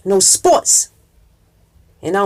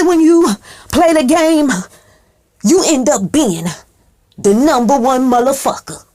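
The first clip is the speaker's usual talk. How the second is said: preacher shout; 4 semitones higher, 3 dB louder than usual. Acted. Preaching the point at full force.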